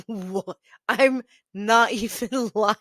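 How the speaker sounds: incredulous laugh through the words. Real, not acted. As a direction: break up laughing while insisting it's true. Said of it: "I'm laughing right now".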